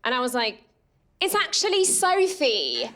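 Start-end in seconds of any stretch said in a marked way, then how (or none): 1.2-2.9 high, slow put-on English accent. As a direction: pass yourself off with an English accent. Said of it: "It's only ever come in handy".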